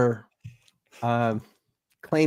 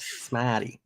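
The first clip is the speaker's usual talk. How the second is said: softer Boston accent, stretched-out "Smarty"; 3 semitones lower, 9 dB quieter than usual. Shown as a demonstration. Co-host teaching the host, snarky Boston accent. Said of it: "stretch out the R's".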